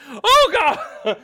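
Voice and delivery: high, loud panicked shrieks of Oh God; shaky stammering panic. Acted. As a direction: shriek in horror, breaking up. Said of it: "The mayor walks over and she goes".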